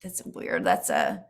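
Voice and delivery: hoarse, breaking voice. Real, not acted. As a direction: voice cracking and dropping low. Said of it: "my voice is hoarse today".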